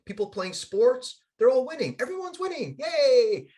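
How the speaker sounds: high-pitched, loud celebration; excited, voice climbing high; mock cheering. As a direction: cheer along with the winning crowd. Cheering scene where everyone wins.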